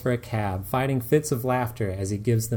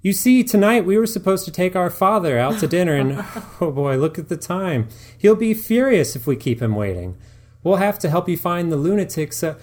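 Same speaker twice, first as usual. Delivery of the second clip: higher, louder hurried fake excuse; Fraser making excuses; 7 semitones higher, 5 dB louder than usual. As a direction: bluster politely toward the door. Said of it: "says Fraser".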